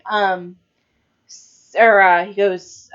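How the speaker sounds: usual speaking voice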